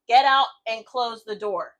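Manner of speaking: slow, loud barked order; doctor ordering the techs out, loud and slow; retold yell. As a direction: bark it out slowly at the staff. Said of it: "yelled at them".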